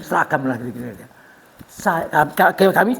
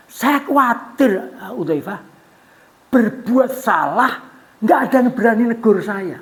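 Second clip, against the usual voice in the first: slow, louder, pleading worried Umar; worried caliph; 4 semitones higher, 6 dB louder, slower than usual. Acted. Umar tells Hudzaifah his worry, slow and anxious. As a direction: drag it out, anxious and earnest. Umar voiced confessing fear.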